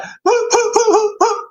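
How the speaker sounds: high, put-on blubbering; mock sobbing. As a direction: fake sobs of a tearful pilot. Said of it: "and he's like".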